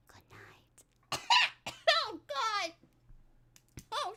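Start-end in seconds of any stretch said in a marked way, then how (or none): 0.1-1.2 soft breathy unvoiced goodnight; whisper. Real, not acted. 1.3-2.0 sudden loud phlegmy cough; choking cough. Real, not acted. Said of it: "I was choking on phlegm".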